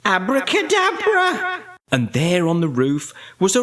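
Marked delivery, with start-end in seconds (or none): 0.0-1.6 high, ringing witch's incantation; shouted magic spell. A narrator's reading. Winnie waves her magic wand, high shouted spell. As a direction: cry the spell out high, holding the last sound. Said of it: "waved her magic wand and shouted".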